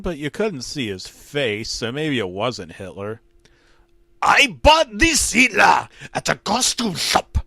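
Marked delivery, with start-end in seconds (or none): 4.2-7.4 put-on high strained German-accented shout. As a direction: shout it in the dictator voice. Same Hitler voice continues.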